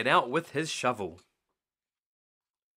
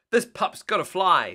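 bright, louder eager cry; exclaimed; 5 semitones higher, 8 dB louder than usual. Acted. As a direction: call it out like you can't wait to take off. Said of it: "Sky exclaimed".

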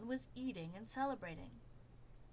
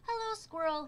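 high, loud eager greeting; cheery Groundhog; 9 semitones higher, 9 dB louder than usual. A narrator's reading. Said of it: "he said".

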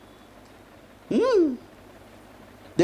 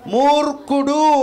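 higher, louder, slow మూర్ఖుడు, each syllable drawn out; slow emphatic answer; 9 semitones higher, 5 dB louder, slower than usual. Acted. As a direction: land మూర్ఖుడు slowly and loud as the verdict. Preacher calls out the answer. (మూర్ఖుడు అని నెమ్మదిగా గట్టిగా)

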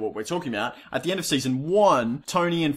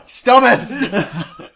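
gasping laugh, louder and higher; 10 semitones higher, 8 dB louder than usual. Real, not acted. Co-host cracking up, begging her partner to stop. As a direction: burst out laughing through the words.

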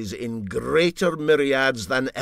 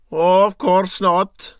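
louder, slow, teasing "of course not"; mock agreeing captain; 10 semitones higher, 13 dB louder, slower than usual. Captain teasing the engineer, mock agreement. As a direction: agree with exaggerated innocence. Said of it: "said Parahandy".

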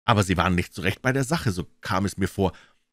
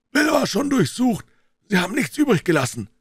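high, raised voice; called-out report; 5 dB louder than usual. A narrator's reading. Gang member to blind man, called-out report. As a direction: shout the report to the boss.

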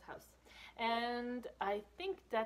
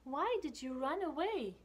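hurt question; 6 semitones higher, 7 dB louder than usual. Acted. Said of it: "he says".

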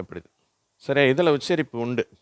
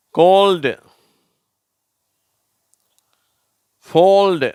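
loud, high-pitched, one word at a time; model word reading; 7 semitones higher, 8 dB louder, much slower than usual. Shown as a demonstration. Teacher reading words for pupils to repeat, loud model reading. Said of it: "நான் வாசிக்கிறேன், நீங்களும் திருப்பி சொல்லுங்க".

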